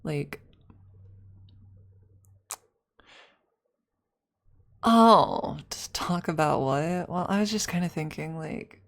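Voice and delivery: hesitant crush, lightly voiced; shy awkward classmate; nervous classmate roleplay. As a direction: fumble through inviting a classmate over. Roleplay classmate invite monologue.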